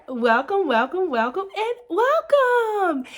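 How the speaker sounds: rising welcomes, last one high and slow; sing-song welcome. Pretend. Vlogger greeting new viewers in a high, drawn-out sing-song.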